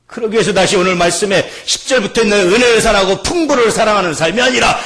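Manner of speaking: loud shouted exhortation; full-force preaching. Real, not acted. Preacher urging love of Jesus and the word, impassioned shout. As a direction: drive each phrase home with fervour.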